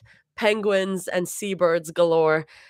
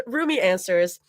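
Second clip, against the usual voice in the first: ordinary delivery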